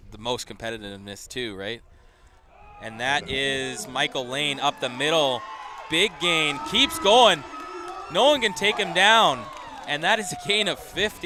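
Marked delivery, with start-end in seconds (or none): 2.8-11.3 excited play-by-play. Real, not acted. Live play-by-play of a big run.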